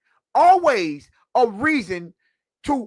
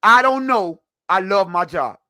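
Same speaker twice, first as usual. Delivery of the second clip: mock noncommittal answer; 2 semitones lower than usual. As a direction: replay the player's dodge emphatically, in two separate beats. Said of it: "he was like".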